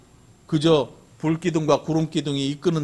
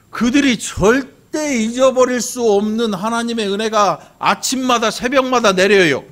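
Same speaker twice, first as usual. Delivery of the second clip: high, loud, stretched proclamation; emphatic sermon climax with drawn-out key words; full-force preaching; 9 semitones higher, 6 dB louder than usual. Real, not acted. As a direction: drive the point home to the congregation.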